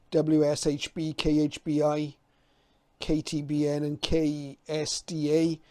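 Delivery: ordinary delivery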